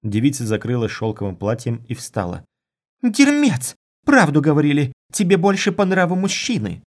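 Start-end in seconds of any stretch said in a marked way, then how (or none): 3.0-6.8 loud, high-pitched outburst; furious insult. Acted. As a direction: hurl it at him in outrage. Spurned girl insults departing lover.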